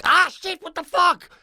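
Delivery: high, sharp pained yelp; retold yelp. Retelling how he yelped when boiling water splashed him. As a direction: cry out as the water splashes you. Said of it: "and I'm like".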